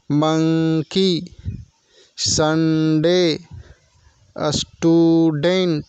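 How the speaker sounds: very slow, each syllable pulled apart. Shown as a demonstration. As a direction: break each word into its syllables slowly. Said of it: "आप इसे उच्चारण करके देख सकते हैं". (शब्दांश तोड़कर धीरे-धीरे)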